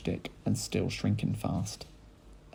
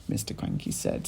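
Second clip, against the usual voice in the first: usual speaking voice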